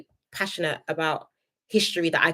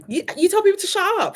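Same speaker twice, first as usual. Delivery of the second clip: high-pitched, scandalised challenge; incredulous telling-off; indignant disbelief; 11 semitones higher, 3 dB louder than usual. Real, not acted. Indignant at being told shut up.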